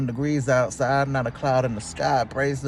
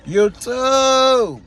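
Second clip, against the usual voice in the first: high, excited YouTube shout; vlog greeting shout; 9 semitones higher, 4 dB louder than usual. Real, not acted. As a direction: call it out to the viewers. Vlog intro call-out.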